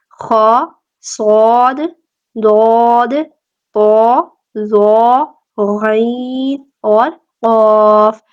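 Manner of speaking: each letter said loud and heavy; emphatic letters. Shown as a demonstration. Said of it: "کون کون سے؟".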